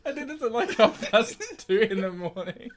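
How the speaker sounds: high-pitched excuse squeezed through laughter; giggling helplessly while explaining; laughing. Real, not acted. Host excusing his late-night script to co-host, laughing.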